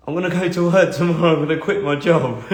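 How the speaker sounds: retold announcement broken by a laugh; chuckling through the words. Real, not acted. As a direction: say it with a laugh escaping. Nervous laugh while retelling his announcement.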